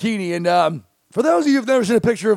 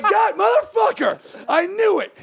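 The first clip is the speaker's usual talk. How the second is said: high, drawn-out cursing in retold frustration; exasperated outburst; 9 semitones higher, slower than usual. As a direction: curse it out high, with a laugh behind it. Retells reaction to plate taken away.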